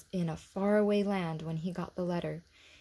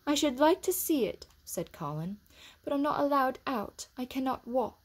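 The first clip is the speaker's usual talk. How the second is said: put-on wistful boy; 7 semitones higher than usual. A narrator's reading. Colin wistful.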